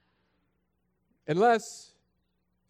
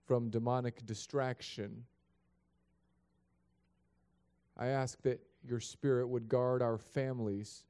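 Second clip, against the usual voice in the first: quiet, earnest prayer voice; 6 semitones lower, 4 dB quieter than usual. Acted. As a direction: pray softly over the church. Opening prayer before the sermon.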